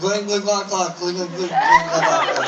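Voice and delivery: loud, gurgling alien babble; loud gurgled Martian talk; Martian gibberish. Acted. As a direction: gargle nonsense words like an alien eating. Volunteer speaking Martian gibberish.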